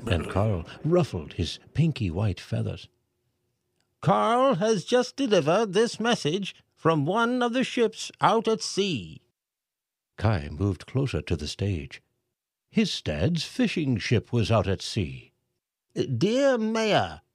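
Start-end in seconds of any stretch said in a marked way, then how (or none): 4.0-9.2 high, pompous mayor voice reading aloud; mayor reading letter. A narrator's reading. Mayor reads the pelican's message to the crowd, pompous. 15.9-17.2 high, pompous mayor voice reading aloud; mayor reading letter. A narrator's reading. Mayor reads the pelican's message to the crowd, pompous.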